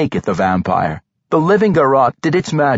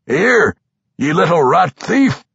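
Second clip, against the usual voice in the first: higher-pitched, rough-spoken dwarf accusation; menacing dwarf; 6 semitones higher, 3 dB louder than usual. A narrator's reading. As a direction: accuse the thief as you stalk closer. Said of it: "the dwarf muttered".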